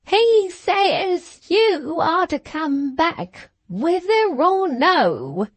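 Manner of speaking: high, slow screech out a window. A narrator's reading. Secretary screeches the order from the upstairs window, high and slow. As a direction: call up high and drawn out. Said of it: "screeching out of the window".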